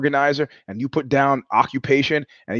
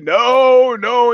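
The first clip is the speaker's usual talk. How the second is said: higher and louder ghostly wail; 10 semitones higher, 6 dB louder than usual. Retold or imitated. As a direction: wail like a haunting spirit. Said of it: "the ghostly voices".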